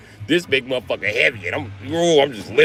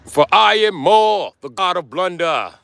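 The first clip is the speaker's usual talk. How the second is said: high, slow booming god voice; mock god; 7 semitones higher, 4 dB louder, slower than usual. Mechanic hamming a thunder god with his hammer, booming.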